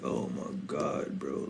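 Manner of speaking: drawn-out fed-up sigh of a reply; exasperated groan. Retold or imitated. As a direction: let it sink with fed-up disbelief. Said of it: "I'm just like".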